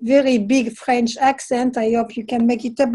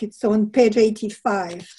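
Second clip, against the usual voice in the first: usual speaking voice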